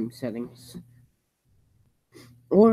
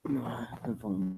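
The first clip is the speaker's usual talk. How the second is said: breathy whispered aside; whispering; 8 dB quieter than usual. Real, not acted. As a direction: whisper it to yourself. Muttering to himself on stream.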